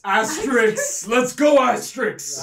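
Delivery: high, loud hype chant; loud cheering. Acted. Stoic on the battlefield rooting for Astrid, loud cheering. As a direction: cheer them on from across the battlefield. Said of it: "he just goes".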